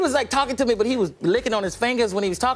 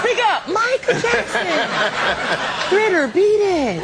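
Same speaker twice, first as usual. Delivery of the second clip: high, slow teasing Michael voice; mock [name]; 12 semitones higher, 4 dB louder, slower than usual.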